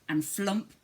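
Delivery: ordinary delivery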